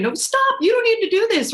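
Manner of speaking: imitating a panicked mom, pitch shooting up; mock anguished pleading, very high; retold tearful plea. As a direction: beg him to stop like a frightened mother. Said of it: "I'm crying".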